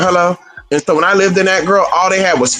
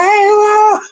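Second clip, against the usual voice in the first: much higher, a bit louder startled hey; startled greeting; 3 dB louder than usual. Retold or imitated. Retelling meeting him, a much higher startled hey. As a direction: jump up high as if caught off guard. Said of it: "I was like".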